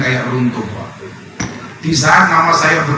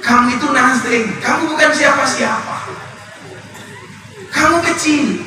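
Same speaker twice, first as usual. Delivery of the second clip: high, commanding divine declaration; preacher voicing God, higher pitch; 11 semitones higher than usual. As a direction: speak as God humbling a proud man. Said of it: "kata Allah".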